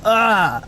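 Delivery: high yelp at an icy hit; sudden high cry; cry from cold blast. Acted. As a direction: yelp as if struck by freezing cold. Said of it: "sound of someone getting hit".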